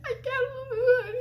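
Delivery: very high mock-sobbing squeak; freaking out. Panicking student to her boyfriend, high tearful squeak. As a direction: squeeze it out as if crying in panic. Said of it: "freaking out".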